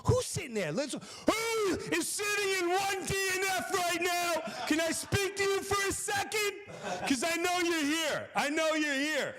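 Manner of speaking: high, slow, put-on Long Island wiseguy; Long Island Jimmy. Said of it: "Long Island Jimmy's going".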